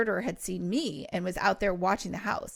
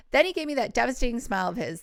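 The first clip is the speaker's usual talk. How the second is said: plain reading voice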